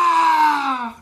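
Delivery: a high mock scream from behind a door; retold scream. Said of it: "se escuchaban gritos". (grito imitado, agudo y lejano)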